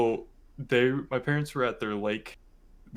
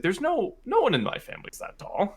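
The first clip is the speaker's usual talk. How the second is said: much higher, confused imitation; baffled mom; 13 semitones higher than usual. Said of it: "So it's like".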